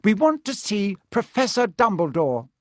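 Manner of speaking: nervously bold schoolgirl; rather brave. A narrator's reading. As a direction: speak up to a strict teacher. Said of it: "said Hermione rather bravely".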